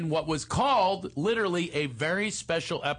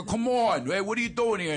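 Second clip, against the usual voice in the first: higher, put-on boxer voice; dumb boxer; 7 semitones higher than usual. Ghost boxer greeting the newly dead, as a dumb boxer. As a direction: dumb fighter hailing a newcomer. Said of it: "I played a dumb boxer".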